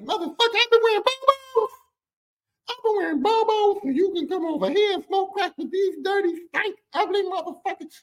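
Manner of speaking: high-pitched furious rant at her dad; a sister who has had enough; sister's outrage. Retold or imitated. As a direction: scream it at the crack house crowd. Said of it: "I think she felt the same way".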